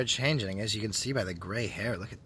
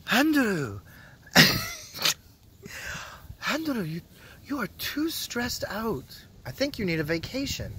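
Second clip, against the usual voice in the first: much higher, sing-song mock girlfriend voice; imitating girlfriend; 12 semitones higher than usual. Vlogger imitating his girlfriend telling him to relax, high mock voice. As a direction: play her, high and fussing. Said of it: "she talks like this".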